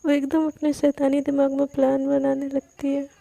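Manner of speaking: ordinary delivery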